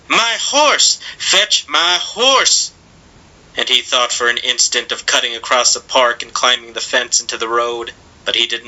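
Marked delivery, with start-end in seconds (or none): 0.1-2.7 high, drawn-out angry shout; man shouting. A narrator's reading. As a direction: call for the horse urgently, slowly.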